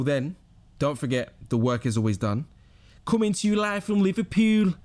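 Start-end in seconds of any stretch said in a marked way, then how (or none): 3.1-4.7 hammed-up Scouse announcer, high and loud; playful, raised-pitch Scouse impression; Liverpool accent. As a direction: put on a mock Liverpool accent. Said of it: "Apologies if I offended anyone with that accent".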